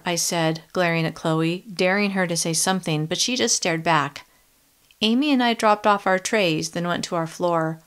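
ordinary delivery

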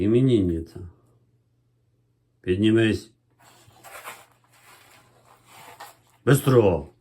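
coaxing a puppy to stand up; talking to a dog. Real, not acted.

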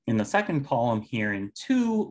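usual speaking voice

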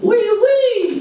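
high squeaky French oui oui; mock French. Mocking the French for the crowd, squeaky mock French. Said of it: "they say that all the time".

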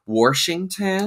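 Washington drawn out as Warshington; older people's pronunciation. Retold or imitated. Guest mimics older people, Washington slow with an added R. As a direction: add an R to Washington, said slowly. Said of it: "a lot of older people say".

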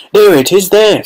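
high, loud eager shout. A narrator's reading. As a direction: point and call out. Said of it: "someone else shouted".

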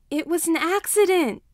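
high, loud tearful wail; mild child's wail. A narrator's reading. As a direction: cry it out like a hurt five-year-old. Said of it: "Denki wails".

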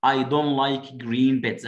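slow, careful textbook English; drawn-out classroom line; slow model reading. Shown as a demonstration. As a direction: read it word by word for kids. Reading child's line slowly.

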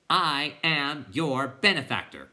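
crying out. A narrator's reading. Bastian repeating for the clown, slow loud cry. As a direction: proclaim each word distinctly. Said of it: "cried Bastian".

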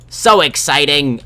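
slow, high, loud excitement. Pretend. Player thrilled about enchanting a sword, loud excitement. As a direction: belt it out, stretched and high.

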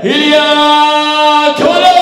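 loud, high, slow fighter name; booming stage introduction. Acted. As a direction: stretch the name out.

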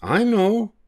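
high, bright monkey voice with an idea; little monkey's idea. Acted. One little monkey announcing a plan, high and bright. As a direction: pipe up like a clever little monkey.

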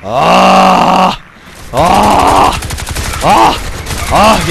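loud high yelps of pain; repeated loud cries of pain. Real, not acted. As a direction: cry out with each hit. Getting shot in a raid.

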